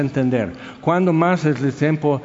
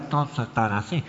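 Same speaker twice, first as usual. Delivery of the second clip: low, soft, stopped-up Mazatec accent; muffled Mazatec accent; 5 semitones lower, 8 dB quieter than usual. Shown as a demonstration. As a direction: imitate a Mazatec accent as if blocked up. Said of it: "la pronunciación es como si estuvieran tapados".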